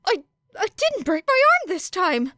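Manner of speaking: high, loud amazed stammer; shocked. Acted. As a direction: blurt it out in disbelief. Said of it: "Midoriya was so shocked".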